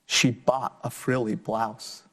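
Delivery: put-on lively, sing-song story reading for kids; reading to children. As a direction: bring the picture book alive for little listeners. Reads picture book aloud.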